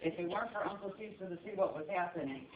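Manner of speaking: ordinary talk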